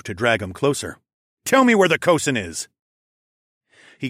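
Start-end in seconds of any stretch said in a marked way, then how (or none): 1.4-2.7 loud, higher-pitched interrogation; forceful raised-voice order; demanding. A narrator's reading. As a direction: demand an answer from your prisoner. Interrogating a bound soldier.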